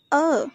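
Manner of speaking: "a" as 'er' with no h; weak form of 'her'. Shown as a demonstration. Teacher showing learners the weak form of her, "a" as 'uh'. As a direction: drop the h, leaving a schwa for "a". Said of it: "Weak form of her is".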